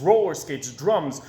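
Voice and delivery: ordinary delivery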